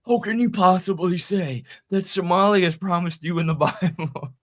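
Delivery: lightly voiced troll claiming a holy promise; mock pious troll. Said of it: "kept responding to these angry right-wingers".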